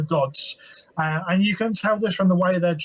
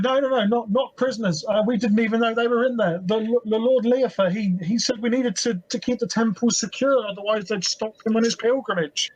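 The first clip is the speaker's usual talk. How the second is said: a lightly voiced scared guard, pleading higher; frightened guard; 5 semitones higher than usual. The guard pleads with the party, scared and higher. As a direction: beg to be let go.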